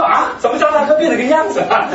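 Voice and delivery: high-pitched, laughing disbelief; amused astonishment. Retold or imitated. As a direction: retell your amazement at someone transformed. Retelling own astonishment, with laughter.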